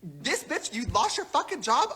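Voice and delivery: normal talking voice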